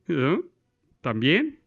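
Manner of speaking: ordinary delivery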